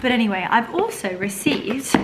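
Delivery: normal talking voice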